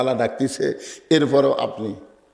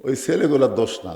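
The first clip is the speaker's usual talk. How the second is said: ordinary delivery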